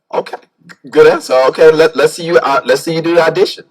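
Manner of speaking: loud approving director. Retold or imitated. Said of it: "He's like".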